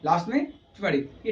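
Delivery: normal talking voice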